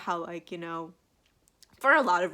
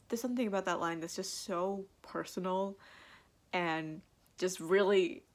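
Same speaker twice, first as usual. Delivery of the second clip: usual speaking voice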